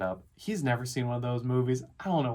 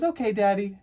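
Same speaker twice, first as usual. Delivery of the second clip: put-on higher, louder kid voice; imitated little boy; 8 semitones higher, 5 dB louder than usual. Dad mimics his son humouring him, high child voice. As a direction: chirp it like a child going along.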